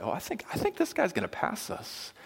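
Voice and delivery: high-pitched mock worry; threatened. Coworkers watching a rising star overtake them, mock-threatened. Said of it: "starts to feel a little threatened".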